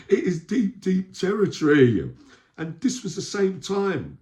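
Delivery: loud, high laugh into slowed words. Real, not acted. Looking back on the prison betrayal, laughing loudly.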